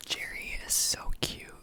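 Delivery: hushed secret whisper. Acted. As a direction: whisper so the cook can't hear. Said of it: "Sorry, I have to whisper".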